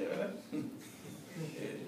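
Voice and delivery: ordinary talk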